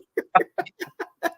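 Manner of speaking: squealing laugh; high laugh. Real, not acted. Laughing at the movie quote, high squeal. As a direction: burst into a high giggle.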